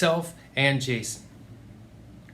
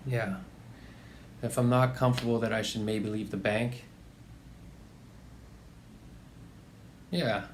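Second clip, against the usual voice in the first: low, quiet, taken-aback reply; subdued; 4 semitones lower, 8 dB quieter than usual. Acted. Employee repeating the boss's rebuke on the phone, subdued. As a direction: echo the rebuke back, holding it in.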